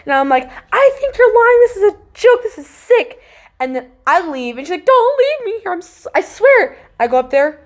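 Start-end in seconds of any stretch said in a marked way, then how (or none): 0.7-3.2 very high, loud protest; high-pitched, upset mock outburst; retold panicked accusation. Retelling tearful accusation in panic. 4.9-6.8 high-pitched begging; very high, panicky retold plea; imitated frantic plea. Voicing cousin begging her to stay.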